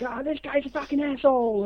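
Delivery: high, mocking angry grumble; ranting actor. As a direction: complain about the crew guy. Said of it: "He says something like".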